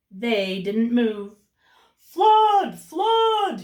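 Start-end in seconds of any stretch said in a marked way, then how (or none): 2.0-3.6 much higher, loud alarm shout; crying flood. Acted. Cat yells a fake flood alarm at the birds, high and loud. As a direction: yell it to frighten the birds off. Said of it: "he cried".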